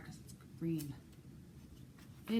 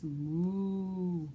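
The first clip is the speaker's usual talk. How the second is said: cow moo; 3 dB louder than usual. Pretend. Librarian imitates cow.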